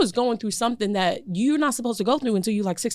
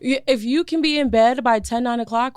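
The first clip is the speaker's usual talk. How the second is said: normal talking voice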